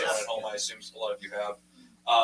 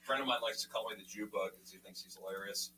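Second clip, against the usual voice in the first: ordinary talk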